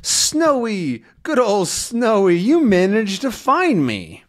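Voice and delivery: high, slow, happy voice for the dog; delighted greeting. Acted. Tintin greets his rescuing dog.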